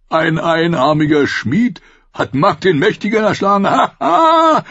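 high, loud laughing through words; wildling bellowing with laughter; roaring laughter. A narrator's reading. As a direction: laugh in delight. Tormund laughing.

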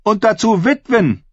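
high, projected speech to an assembly; sway the crowd with measured rhetorical questions; ringing, high-pitched declamation; bold woman's oratory. A narrator's reading.

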